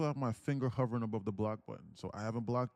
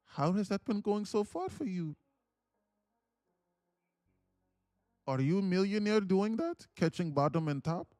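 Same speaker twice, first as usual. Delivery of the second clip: high-pitched mock Indian accent; questioning in a put-on Indian accent, pitched up and louder; 9 semitones higher, 4 dB louder than usual. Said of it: "in this Indian voice".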